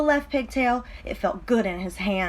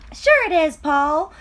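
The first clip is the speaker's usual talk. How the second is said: high coaxing pigtail voice; urging pigtail; 6 semitones higher, 6 dB louder than usual. Acted. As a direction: coax him into one little tug. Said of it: "said the pigtail".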